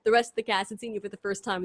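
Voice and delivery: usual speaking voice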